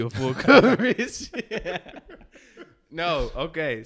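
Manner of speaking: laughing through the words; cracking up mid-sentence. Real, not acted. Laughing at his own joke.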